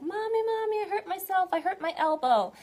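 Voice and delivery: put-on high, loud whiny little kid; child wailing to mommy over a hurt elbow; crying child. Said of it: "they start crying and they're like".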